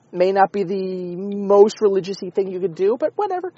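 ordinary talk

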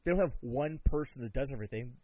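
normal talking voice

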